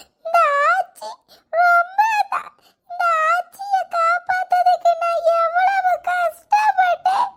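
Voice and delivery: wailing crying. Acted. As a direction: wail at being left behind, slow and high. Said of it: "என்னத்துக்கு அழுதுட்டு இருக்க?".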